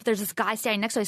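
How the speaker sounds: ordinary talk, nothing marked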